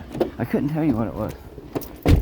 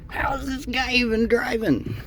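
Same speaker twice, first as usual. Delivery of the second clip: high mock viewer voice; 14 semitones higher than usual. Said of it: "they were all like".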